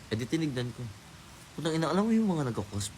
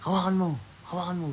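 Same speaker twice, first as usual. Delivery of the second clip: retold command, hammed up a little; mock shout; 10 semitones higher than usual. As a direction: bark it like a pushy boss, half in jest. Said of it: "sumisigaw siya".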